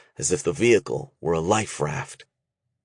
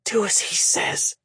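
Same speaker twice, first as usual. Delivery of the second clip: breathless whisper; Shay whispering to the men at first; 13 semitones higher than usual. A narrator's reading. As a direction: breathe it, barely voiced. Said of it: "Shay whispered".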